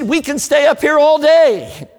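put-on hamming up the cocky teen pilot, high and loud; boastful rookie pilot.

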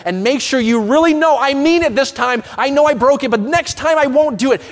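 rising into a loud, pleading rush of promises; pleading believer. Acted. Preacher voicing a believer begging God to accept him, loud and pleading. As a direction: beg God to let you prove yourself.

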